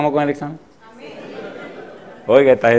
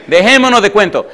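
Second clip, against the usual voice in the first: forceful exhortation; 4 semitones higher, 7 dB louder than usual. Real, not acted. Preacher confronting the congregation.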